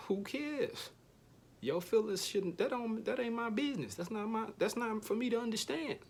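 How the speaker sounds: high, dismissive and flippant; mock dismissive. Loner waving off other people's feelings, high flippant. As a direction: brush it off, way up high.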